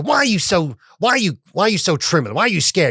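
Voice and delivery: high, pressing, mocking questions; mock interrogation. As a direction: ham up the suspicious master. Calvin Candie presses the housekeeper.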